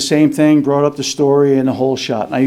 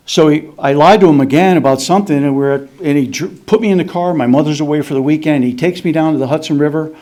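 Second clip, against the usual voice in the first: normal talking voice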